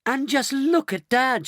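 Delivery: high, drawn-out admiration; marvelling. Acted. Daughter pointing out Grandpa George, marvelling. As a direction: gush slowly in a high voice. Mrs Bucket admires her younger father.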